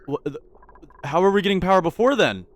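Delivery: ordinary delivery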